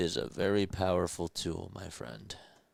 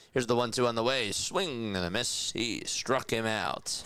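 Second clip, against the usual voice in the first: higher, louder strikeout call, slowing at the end; excited play-by-play; 6 semitones higher, 4 dB louder than usual. Acted. Streamer calling the strikeout for chat, announcer voice. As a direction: sell the strikeout like a broadcaster.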